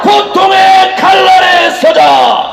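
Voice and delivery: high, slow shouted battle cries; roaring rallying speech. Acted. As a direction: roar each slogan at the crowd.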